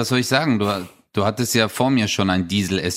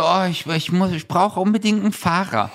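whiny diva impression; 7 semitones higher than usual. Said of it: "Der so".

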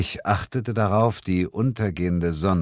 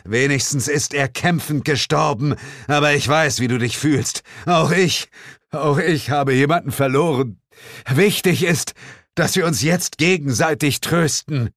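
high, emphatic condolence; raised-voice, heartfelt mourning speech; earnest consoling; 8 semitones higher, 4 dB louder than usual. Acted. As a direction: console someone with full dramatic emotion. Mock-solemn condolence before being interrupted.

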